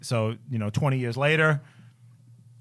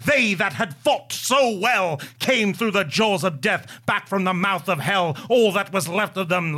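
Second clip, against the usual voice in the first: higher, louder, declaiming every line at full force; passionate poem recital; 5 semitones higher, 4 dB louder than usual. Shown as a demonstration. Host reciting Tennyson to his co-hosts on request, loud passionate declaiming. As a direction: yell the poem like a rousing battle recital. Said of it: "give a passionate, passionate reading of Charge of the Light Brigade".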